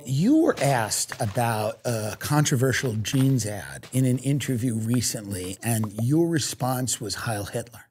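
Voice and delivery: lightly voiced serious interviewer. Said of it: "The next interviewer will go".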